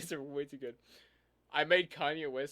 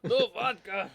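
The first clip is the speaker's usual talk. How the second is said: put-on high, loud Russian-accented cry; 6 semitones higher, 8 dB louder, slower than usual. Host echoing co-host's vodka joke, loud Russian accent. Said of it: "Russian voice improved a lot".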